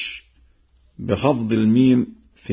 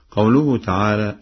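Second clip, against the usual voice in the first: ordinary talk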